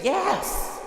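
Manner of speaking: a simple wholehearted yes; emphatic yes. Pretend. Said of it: "Just say".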